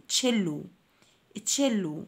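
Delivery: "تشيلو" opening on a ch sound. Shown as a demonstration. Teacher models cello's unusual C for pupils, said as ch. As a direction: say "تشيلو" with ch, never s. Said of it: "بننطقها تشي".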